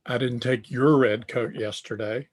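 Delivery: "your" stressed with a rise. Shown as a demonstration. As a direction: lean on "your", it was someone else's. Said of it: "the inflection there is on your".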